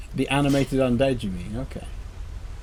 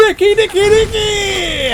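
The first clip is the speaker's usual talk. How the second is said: high, loud, slow squeal of the name; overexcited fan cry; 10 dB louder, slower than usual. Retold or imitated. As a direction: shriek it like a giddy fan leaping at him. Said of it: "I didn't expect you to go".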